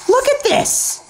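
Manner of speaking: excited shout. Real, not acted. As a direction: burst out in disbelief at the kills. Shows his kills to viewers.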